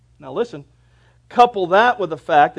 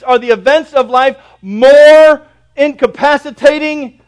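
loud, raised pulpit delivery; full-force preaching; 8 semitones higher, 13 dB louder than usual. Real, not acted.

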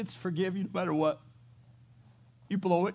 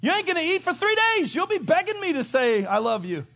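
hammed-up indignant rant, much higher and louder; mock spouse grudge; 9 dB louder than usual. Spouse refusing to forgive partner, hammed-up loud rant. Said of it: "and then you go".

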